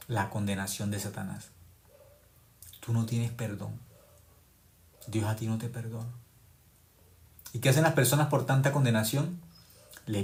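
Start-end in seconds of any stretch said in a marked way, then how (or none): none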